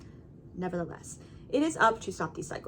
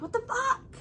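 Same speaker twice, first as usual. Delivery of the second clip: high-pitched exasperated disbelief; indignant, high-pitched mock outrage; angry outburst; 12 semitones higher than usual. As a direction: act out mounting frustration, voice jumping high. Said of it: "it almost makes you angrier".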